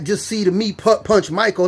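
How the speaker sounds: normal talking voice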